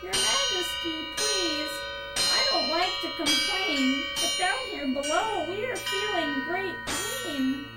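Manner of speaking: groaned complaint. A narrator's reading. Mack complains to the king of pain, groaning. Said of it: "groaned Mac".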